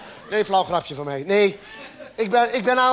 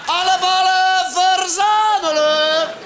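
mock command; 5 semitones higher, 3 dB louder, slower than usual. Rounding up escaped beanbag balls.

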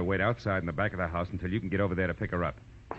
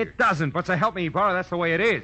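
pitched high and loud, pressing hard; urgent insistence; 10 semitones higher, 3 dB louder than usual. Acted. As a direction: make him believe you, now. Detective insists urgently to lieutenant.

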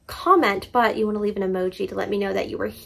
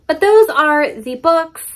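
emphatic; 6 semitones higher, 7 dB louder, slower than usual. Real, not acted. Emphatic declaration of favorites.

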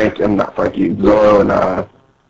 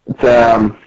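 normal talking voice

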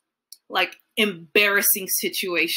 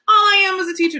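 exasperated outburst; 10 semitones higher, 6 dB louder, faster than usual. Real, not acted.

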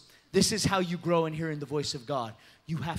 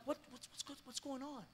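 high, hushed, stammered question; confused; 5 semitones higher, 17 dB quieter, faster than usual. Retold or imitated. Preacher to the man next to her, confused and hushed. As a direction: ask softly, as if lost. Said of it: "I'm confused".

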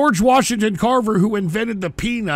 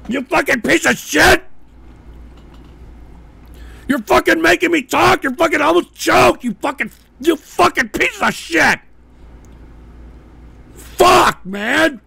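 loud furious swearing; swearing tirade; 8 semitones higher, 6 dB louder than usual. Real, not acted. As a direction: scream the curses. Raging swearing.

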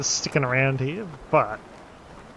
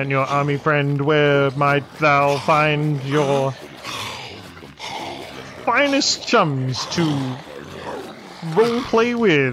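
put-on posh English accent; 3 dB louder, slower than usual. Player asks strangers to roleplay, mock posh English accent. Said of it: "put, like, a posh accent on".

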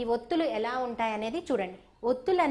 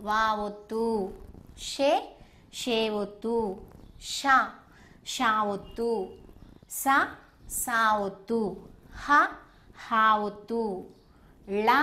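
recital for repeating; 2 semitones lower, slower than usual. Shown as a demonstration. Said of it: "So మీరు చెప్పండి".

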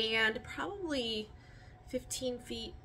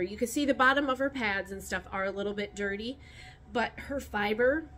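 ordinary delivery

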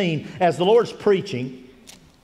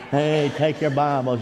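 put-on old man; 5 semitones lower, slower than usual. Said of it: "an old man that'll come in and say".

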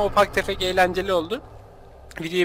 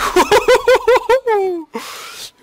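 long, loud, high-pitched laugh; 13 semitones higher, 6 dB louder than usual. Real, not acted. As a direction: whoop with helpless laughter. Patlamaya gülüyor. (uzun, tiz, yüksek kahkaha)

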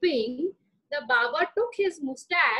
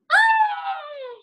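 high shrieking cry of shock; a sudden piercing shriek at the mirror; shocked scream; 7 dB louder than usual. Acted. Raja spots his ruined mustache, shrill scream.

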